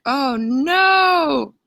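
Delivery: a sudden, sinking "oh no" as she starts crying; dismayed oh no. Retold or imitated. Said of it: "And I'm like".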